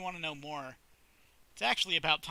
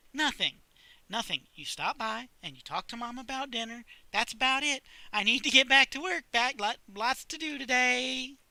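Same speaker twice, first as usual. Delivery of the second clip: put-on higher, louder rushed girl voice; evasive librarian; 8 semitones higher, 5 dB louder than usual. Sally dodging Chuck's question, higher and louder. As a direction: brush it off and flee to work.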